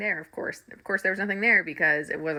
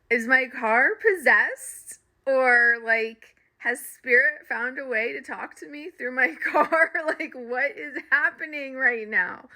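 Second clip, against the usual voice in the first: mock spooked disbelief; 7 semitones higher, slower than usual. Wondering if car possessed.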